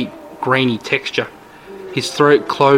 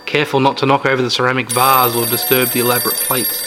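ordinary talk, nothing marked

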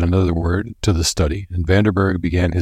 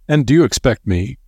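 higher, amused disbelief; smiling scorn; 7 semitones higher than usual. A narrator's reading. Senior doctor scoffing at the warning, higher and smiling. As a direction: laugh him off with a higher smiling tone. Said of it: "smiled Sir Douglas".

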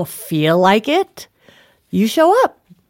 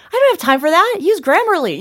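higher, breezy dismissive brush-off; mock brush-off; 10 semitones higher than usual. Mocking her own busy self, higher and dismissive. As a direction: toss it off like you're too busy.

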